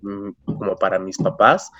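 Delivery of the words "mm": ordinary talk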